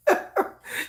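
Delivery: breathless high giggle with no voice; high airy giggle. Real, not acted. Laughing at her husband's teasing, airy giggle. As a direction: let a giggle escape on the breath.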